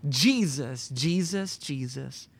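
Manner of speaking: normal talking voice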